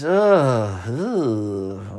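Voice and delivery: groaning through a yawn. Real, not acted. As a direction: let out a yawning ugh. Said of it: "I cannot stop yawning".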